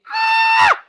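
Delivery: very high, loud scream; sudden high-pitched shriek; piercing mock scream. Retelling how the kid screamed, loud high scream. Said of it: "loud as fuck and, like, really high".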